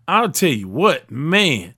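usual speaking voice

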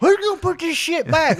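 high indignant yelp; high outraged customer. Pretend. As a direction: squawk it in outrage.

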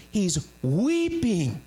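weeping drawn out, very slow; each syllable of weeping stretched and weighted; slow emphasis on weeping. Acted. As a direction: lean hard on weeping, slowly. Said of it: "Doesn't say he just cried".

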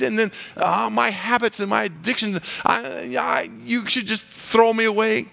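high-pitched, stumbling self-reproach; anguished protest. Acted. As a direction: protest in a high, pleading voice, faltering. Voices self-doubting believer protesting to Jesus.

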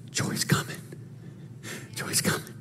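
hushed whisper of a secret promise; God whispering that joy is coming. Acted. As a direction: breathe it out in a whisper.

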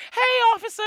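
high sweet greeting; high, sugary hello to the cop; sweet girl voice. Retold or imitated. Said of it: "She goes".

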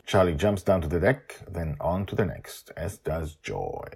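plain reading voice

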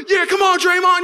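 high, loud cheer from the stands; re-enacted game-day yell; cheering fan. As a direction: urge the player on loudly. Acts himself cheering at game.

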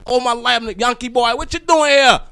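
put-on high-pitched American accent, building to loud. Proud American declaring himself a Yankee boy, in a high put-on American accent. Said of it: "I thought he had an accent".

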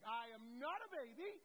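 loud, high mock tantrum; hammed-up kid's outburst; protesting five-year-old. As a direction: imitate an indignant small child. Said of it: "our five-year-old who's like".

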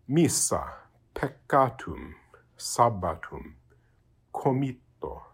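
missa, peccatum, sabbatum, comitto, each doubled consonant held; overemphasized double consonants. Shown as a demonstration. Teacher overdoes examples to give an idea, missa, peccatum, sabbatum, comitto with doubled consonants. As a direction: split each double: mis-sa, pec-catum, sab-batum, comit-to. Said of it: "overemphasizing that".

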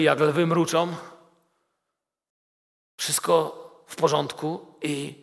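usual speaking voice

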